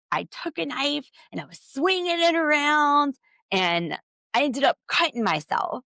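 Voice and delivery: a hint of [name]'s melodrama; dramatic [name]. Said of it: "[name] gets super dramatic".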